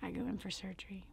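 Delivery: "I go in for surgery" whispered, fast and hushed. Shown as a demonstration. Telling listeners about her surgery, hushed whisper. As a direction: drop to a hushed whisper as the story turns dark. Repeats line, second whispered.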